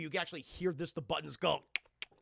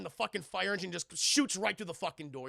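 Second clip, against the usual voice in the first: normal talking voice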